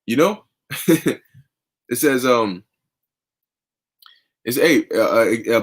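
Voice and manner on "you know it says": normal talking voice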